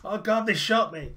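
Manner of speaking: high, loud wail of alarm; hurt, alarmed cry. Acted. Woman fallen clutching her shoulder, alarmed shouting. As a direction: cry out for all to hear. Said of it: "clutching at her shoulder".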